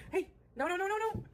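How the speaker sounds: sharp high call to stop the cat; scolding the cat. Real, not acted.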